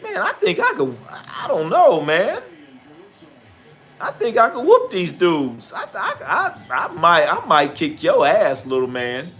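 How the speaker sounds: starts high, then grinning swagger; hammed-up tough-guy bragging; mock cocky boast. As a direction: puff up like you could take him, half laughing. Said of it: "And I was like".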